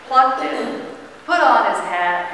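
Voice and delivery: usual speaking voice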